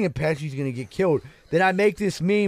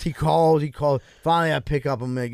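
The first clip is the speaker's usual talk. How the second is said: usual speaking voice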